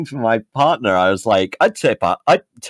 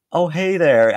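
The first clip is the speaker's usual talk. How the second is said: higher-pitched attempt at an American-Canadian accent; put-on North American accent; 6 semitones higher than usual. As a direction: fake an American-Canadian phone greeting to surprise them. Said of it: "American Canadian accent".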